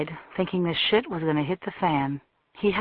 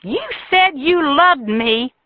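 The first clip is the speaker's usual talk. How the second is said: much higher, slow and loud; wounded accusation; 10 semitones higher, 5 dB louder, slower than usual. A narrator's reading. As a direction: drag out the hurt accusation. Jilted woman accuses Tucker.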